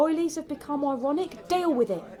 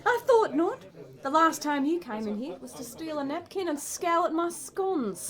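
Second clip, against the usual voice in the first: put-on Aussie twang; silly Australian accent; 3 semitones higher than usual. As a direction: play up a fake Australian accent. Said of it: "You can drop that silly accent".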